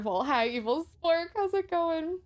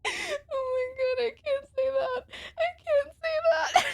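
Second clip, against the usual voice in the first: high, teary laugh in the voice; crying with laughter; 12 semitones higher than usual. Real, not acted. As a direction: squeak it out through tears of laughter. Laughing to tears over line.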